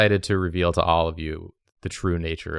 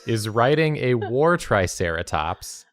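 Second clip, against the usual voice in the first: slow, measured fairy-tale narration; slow proclamation of a royal portrait; grand storyteller; 3 semitones higher, 4 dB louder, slower than usual. Acted. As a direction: unveil the painting like a herald. Describes the drawing grandly.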